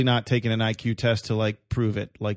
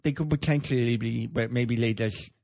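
mocking speech impediment voice. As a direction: talk like your tongue is too big. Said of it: "they just talk like this".

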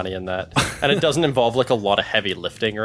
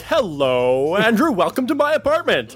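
mimicking a friend's host voice, much higher; mocking radio voice; 9 semitones higher than usual. Teasing his cohost's radio voice at the door, mock announcer. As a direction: greet him like an overdone radio host. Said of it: "I walked in, you're like".

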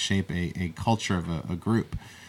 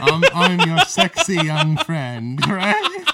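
laughing; 10 semitones higher, 7 dB louder, slower than usual. Acted. Joking flirt.

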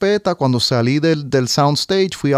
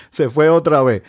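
laughing; 3 semitones higher than usual. Real, not acted. Repeats the line with a laugh.

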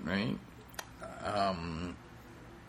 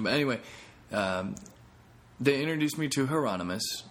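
ordinary talk

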